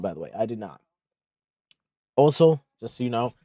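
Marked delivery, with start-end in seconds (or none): none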